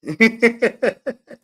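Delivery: high, loud burst of laughter; a big real belly laugh. Real, not acted. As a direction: crack up loudly. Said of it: "I'm laughing, man".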